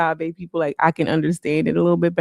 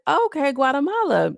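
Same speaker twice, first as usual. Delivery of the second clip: high bright okay; 9 semitones higher than usual. Retold or imitated. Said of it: "I'm like".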